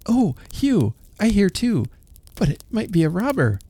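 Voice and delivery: put-on high, timid little girl; a small girl half-scared in the dark. Sister to brother, high and timid.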